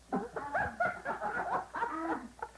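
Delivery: high, breathy burst of laughter; cracking up at the punchline; hearty laugh. Real, not acted. Laughs after the joke.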